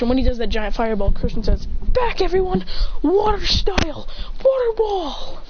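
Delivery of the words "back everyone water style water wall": high-pitched warning to allies; acted anime hero shout; urgent battle call. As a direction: call out the defensive move high over a battle. Warns allies, calls water technique.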